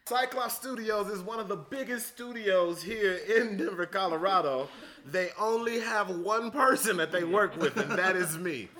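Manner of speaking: high, loud, playful sales pitch; mock promo announcer. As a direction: plug it like a big-time announcer.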